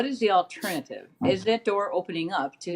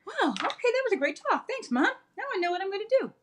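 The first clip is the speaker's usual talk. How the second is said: put-on much higher-pitched grateful child; imitating empowered kid; 14 semitones higher, faster than usual. Mother mimicking her kid after a great talk, high and empowered. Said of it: "they're feeling empowered. They're feeling like".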